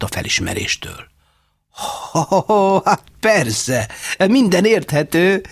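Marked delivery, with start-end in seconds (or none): 1.7-5.4 higher, gleeful ho ho; light up with sudden understanding; happy realization. A narrator's reading. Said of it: "mondta boldogan". (boldog, felismerő hang)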